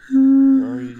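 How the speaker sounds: loud, high surprised question; shocked disbelief. Real, not acted. Surprised at blades.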